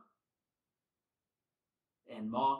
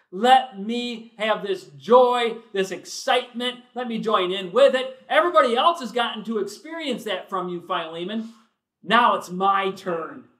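preaching at full force; 6 semitones higher, 13 dB louder than usual. Acted. Preacher voicing Paul asking Philemon for his turn, loud and high. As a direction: cry it out to the rafters.

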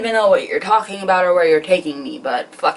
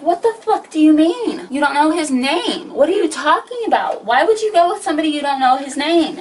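high-pitched, incredulous mock scolding; mock outrage, pitched well up; imitating pissed-off friend; 7 semitones higher than usual. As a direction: grill them like an exasperated best friend. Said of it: "and she's fucking pissed".

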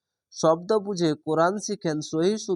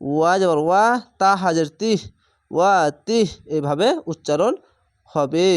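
normal talking voice

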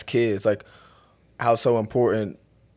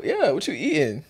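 playful high voice; 6 semitones higher than usual. Pretend. Playful repeat of the catchphrase.

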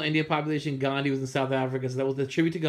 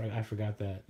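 ordinary talk, nothing marked

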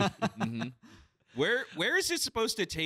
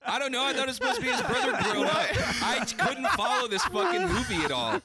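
laughing; 8 semitones higher, 4 dB louder than usual. Real, not acted. Defending confusion amid group laughter.

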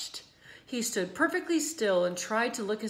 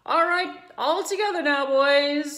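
higher, louder call to the helpers; rally the men to lift together; 7 semitones higher, 10 dB louder than usual. A narrator's reading. Farmer calling his helpers, loud and high. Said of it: "said Mister Zuckerman".

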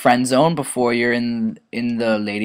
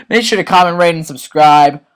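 higher, louder outro call; louder, higher sign-off to viewers; louder repeat; 5 semitones higher, 7 dB louder than usual. Shown as a demonstration. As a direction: shout it again like an announcer.

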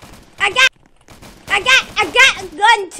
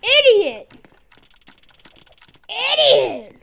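normal talking voice

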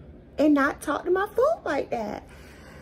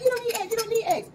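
mocking people; 10 semitones higher, faster than usual. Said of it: "Why people like".

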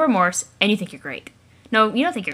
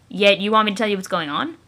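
ordinary talk

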